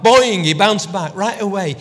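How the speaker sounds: loud springy boing burst; spring the word boing up; bouncy excitement. Acted. Preacher celebrates Peter's quick return, bouncy and loud. Peter leaps back to Jesus.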